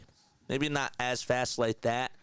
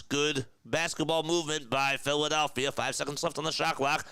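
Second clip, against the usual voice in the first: brisk, raised sportscaster call; play-by-play call; 4 semitones higher than usual. Acted. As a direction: call the game live, on the mic. Live basketball play-by-play.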